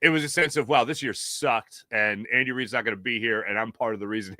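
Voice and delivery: normal talking voice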